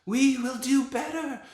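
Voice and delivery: a dad screeching the witch voice while reading; Grand High Witch voice. Acted. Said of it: "here's what it sounds like when you read The Grand High Witch".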